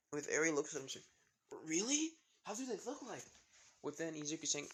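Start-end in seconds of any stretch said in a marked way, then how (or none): 1.7-3.2 put-on high-pitched, wondering child voice; curious little girl. Eri hearing of talking animals, curious little girl. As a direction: ask in a high, amazed little voice.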